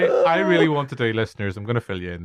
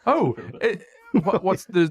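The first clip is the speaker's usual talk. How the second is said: ordinary delivery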